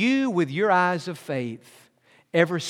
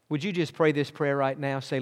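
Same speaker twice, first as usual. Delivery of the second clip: ordinary talk, nothing marked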